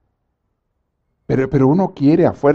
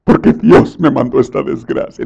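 mock complaint; 3 dB louder than usual. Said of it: "hay descarados que dicen".

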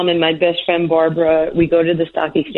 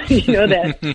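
ordinary delivery

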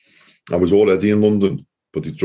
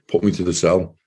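normal talking voice

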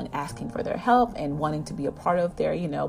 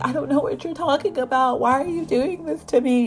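higher, louder fake wail of innocence; mock crying scream; 9 semitones higher, 4 dB louder than usual. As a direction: play the hysterical fake victim. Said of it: "Junwoo crying and screaming".

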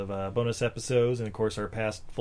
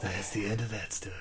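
whisper; 3 semitones lower, 9 dB quieter than usual. Acted. Closing a joke bit quietly.

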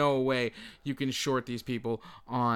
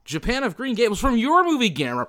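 loud, raised nominee call; loud awards announcement; 9 semitones higher, 9 dB louder, faster than usual. Acted. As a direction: boom the nominee's name at the crowd.

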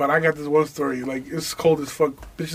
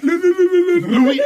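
higher, louder stuttered name through laughter; laughing stutter; 12 semitones higher, 5 dB louder than usual. Real, not acted. Laughing as he stumbles over his homie's name on air. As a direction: crack up while tripping over the name.

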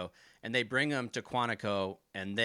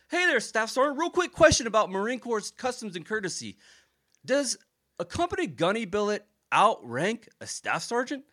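high, loud mock-polite sergeant voice; a sergeant setting a trap with fake sweetness; higher, louder sarcastic question; first sergeant impression; 11 semitones higher, 6 dB louder than usual. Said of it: "I impersonate his voice all the time".

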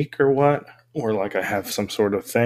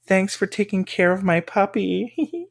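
imitating a scam texter, pitched higher; scammer text voice; 8 semitones higher than usual. Scam texter thanking a wrong number, mock higher voice. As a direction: play the friendly stranger, voice up. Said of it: "and be like".

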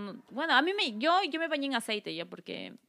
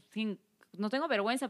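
ordinary delivery

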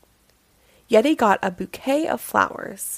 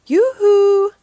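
high, loud sing-out; cheery calling out; 11 semitones higher, 8 dB louder than usual. A narrator's reading. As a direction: call out a long, lifted yoo-hoo. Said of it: "Clown called".

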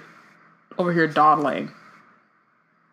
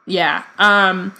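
ordinary talk, nothing marked

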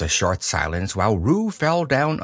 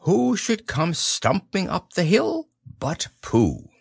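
ordinary delivery, nothing marked

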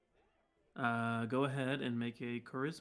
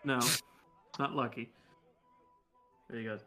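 normal talking voice

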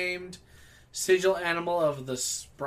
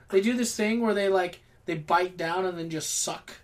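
ordinary talk

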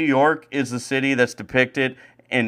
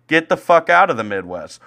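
normal talking voice

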